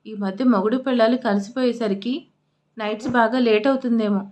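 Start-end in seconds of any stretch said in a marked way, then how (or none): none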